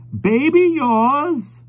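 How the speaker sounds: put-on old man asking over his book; slow high elderly query; old stranger's voice. Old stranger asking about the baby.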